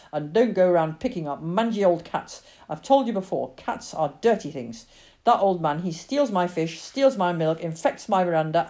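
emphatic, ranting farmer; angry father. Acted. Father blames the old tramp for everything, angry tirade. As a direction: list grievances with mounting disgust.

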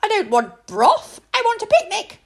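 put-on high loud sulky child; a cross little girl refusing food; scowling princess. Princess refuses the maid's broth, high cross whine. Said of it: "scowled the little princess".

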